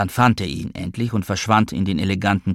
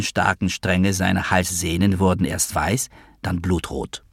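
usual speaking voice

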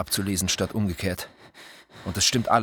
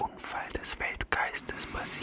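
whispered echo. Acted. Second voice whispers the question back. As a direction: ghostly whisper echoing the question.

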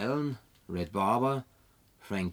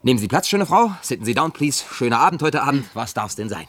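playing bartender; 3 semitones higher, 9 dB louder than usual. Man plays barkeeper for the woman in the empty bar, loud and showy. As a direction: greet her grandly like a bar host.

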